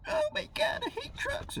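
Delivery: very high falsetto whine, imitating her; mock lady driver. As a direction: squeal it in a mock female falsetto.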